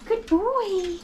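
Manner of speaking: sing-song high praise; high coaxing pet voice. Real, not acted. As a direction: coax him sweetly. Coaxing the dog.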